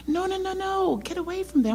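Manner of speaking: ordinary talk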